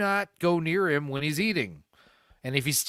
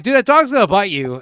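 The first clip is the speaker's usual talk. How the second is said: louder, higher urgent warning to a friend; alarmed warning shout; 6 semitones higher, 11 dB louder than usual. Retold or imitated. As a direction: yell it before the dog snaps. Warning friend about the biting dog.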